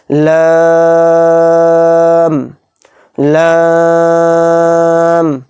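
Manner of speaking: each letter name drawn out long and chanted; slow tajweed-style letter chant; stretched recitation. Shown as a demonstration. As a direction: hold the vowel for three alif counts. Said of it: "تین الف کی مقدار ہم کھینچ کر پڑھیں گے".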